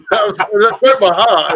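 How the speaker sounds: high loud laughing, slow. Real, not acted. Laughing at own story.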